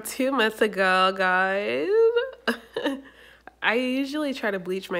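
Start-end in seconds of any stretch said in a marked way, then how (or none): 1.9-3.1 big burst of laughter; loud laugh. Real, not acted. Laughs at own update.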